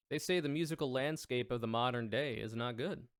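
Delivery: usual speaking voice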